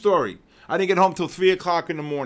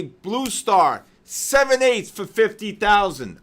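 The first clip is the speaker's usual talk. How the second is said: loud, slow trader call; proud replay of his lost line from Wall Street; reciting his movie line; 5 semitones higher, 7 dB louder, slower than usual. Retold or imitated. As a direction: bark the order slowly across the floor. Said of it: "I had the line to say".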